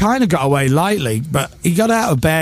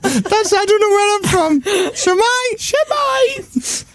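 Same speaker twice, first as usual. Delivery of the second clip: put-on very high squeaky girl voice; little girl voice; 14 semitones higher, slower than usual. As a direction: keep the girly presenter voice.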